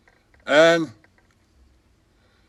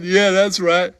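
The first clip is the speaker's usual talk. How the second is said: normal talking voice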